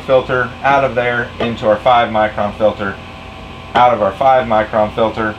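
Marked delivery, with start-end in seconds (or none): none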